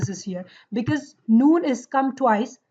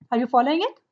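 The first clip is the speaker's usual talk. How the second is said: usual speaking voice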